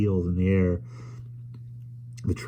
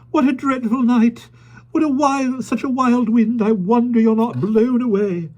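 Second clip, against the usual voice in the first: high, loud dithering lady; fussy lady voice; 6 dB louder than usual. A narrator's reading. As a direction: fret about the wind, high and loud. Miss Lark complaining of the wind.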